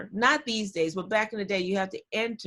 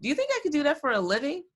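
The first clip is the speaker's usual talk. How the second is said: eager question; 7 semitones higher, much faster than usual. Retold or imitated. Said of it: "looked at him and said".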